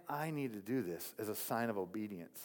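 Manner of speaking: pastor's hushed private resolve; hushed inner voice. Retold or imitated. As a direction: say it quietly to yourself. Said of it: "and I'm like".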